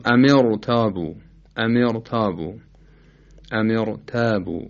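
ام ارتابوا with a thick r; heavy ra. Shown as a demonstration. Teacher repeating the example, heavy r in ام ارتابوا. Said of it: "باید تفخیم بشه".

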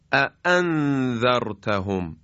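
both hamzas in اَأَنذَرْتَهُمْ struck sharp and forceful; sharp strong hamza. Shown as a demonstration. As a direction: snap out each glottal stop in اَأَنذَرْتَهُمْ. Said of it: "خیلی تیز و با قدرت ادا بشه".

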